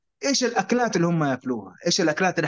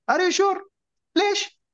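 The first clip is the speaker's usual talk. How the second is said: surprised; 13 semitones higher, much slower than usual. Retold or imitated. Surprised by the gift.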